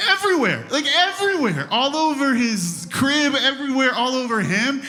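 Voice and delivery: high, loud, exasperated rant; exasperated outburst. Retold or imitated. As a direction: vent about the mess, voice way up. Describing the mess in the crib.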